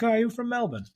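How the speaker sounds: high-pitched mock Melbourne lilt; put-on Melbourne accent, pitched well up. Guest imitating what he thinks on hearing Melbourne people, lilting Melbourne accent. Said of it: "always kinda finish up on the end".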